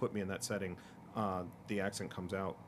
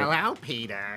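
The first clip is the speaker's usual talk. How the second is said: slow, louder cartoon wife impression; Lois; 6 dB louder, slower than usual. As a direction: draw it out as the cartoon wife greeting her husband. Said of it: "I'm no Alex Borstein either".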